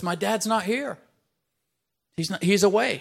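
put-on high, light boyish voice; twelve-year-old boy telling the rider his dad is away; boy's reply. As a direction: answer like a startled child.

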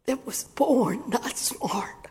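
slow unvoiced near-whisper; hushed. Real, not acted. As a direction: say it gently, almost breathless. Tender memory of brother.

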